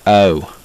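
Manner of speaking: "O" gliding from an A sound into O; Southern twang O. Shown as a demonstration. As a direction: start "O" as A, blend quickly into O. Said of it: "you start to say A".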